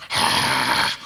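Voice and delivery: low breathy orc grunt. Shown as a demonstration. Showing the orc's stiff expression, low grunt. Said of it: "only two expressions, which was like".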